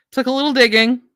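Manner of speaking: light and high; high-pitched remark. Real, not acted. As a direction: pitch the whole phrase up. Repeats remark in two pitches.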